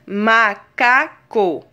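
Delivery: "Macaco" split slowly into ma, ca, co; teacher sounding out "Macaco" in three beats; syllable by syllable. Shown as a demonstration. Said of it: "trissílabas são aquelas que existem três".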